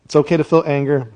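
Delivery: ordinary talk, nothing marked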